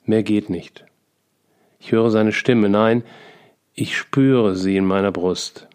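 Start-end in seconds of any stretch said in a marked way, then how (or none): none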